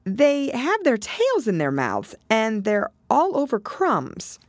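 high, slow recollection; Alice. Acted. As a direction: recall it slowly in a high girl's voice. Alice voiced, recalling whiting to Turtle.

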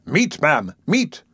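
"meat" stressed hard and stern; stern emphasis. Acted. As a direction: hammer the word "meat" sternly. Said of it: "with stern emphasis".

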